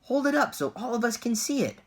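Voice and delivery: high, loud shout from the crowd. A narrator's reading. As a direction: call out over the crowd. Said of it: "somebody shouted".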